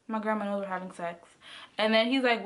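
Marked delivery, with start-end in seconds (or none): 0.1-1.3 said low and soft, hurried; hushed, hurried phone confession. Retold or imitated. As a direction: rush it out under your breath like a secret. Telling boyfriend on phone.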